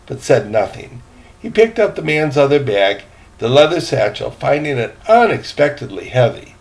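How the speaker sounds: lively storytelling read aloud, rising as the dialogue builds; story narration. Acted. Grandpa reading a story to the grandkids, lively storytelling. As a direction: read the adventure like grandpa to the kids.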